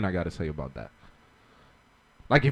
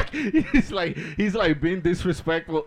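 laughing; 5 semitones higher than usual. Real, not acted. Laughing while talking.

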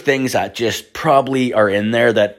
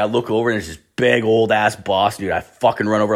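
ordinary delivery

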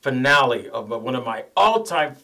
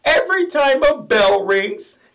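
put-on high, slow little-girl voice; Zuzu; 13 semitones higher, 6 dB louder, slower than usual. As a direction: quote it sweetly like a small child. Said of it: "the line of the little girl, Zuzu, who basically says".

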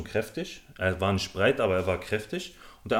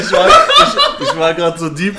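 breaking up into laughter, much higher and louder; words squeezed out between laughs; laughing; 8 semitones higher, 13 dB louder than usual. Real, not acted. Caught off guard on camera, real laughing fit. Laughing, can't finish sentence.

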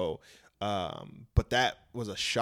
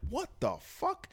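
shocked disbelief; 4 dB quieter, slower than usual. Retold or imitated. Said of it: "So I'm hearing all of this".

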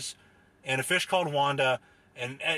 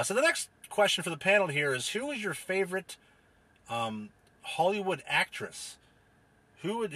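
usual speaking voice

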